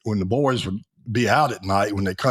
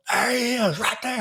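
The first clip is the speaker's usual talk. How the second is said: hollering orderlies; 13 semitones higher than usual. Retold or imitated. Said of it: "Then they holler".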